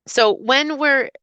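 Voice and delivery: usual speaking voice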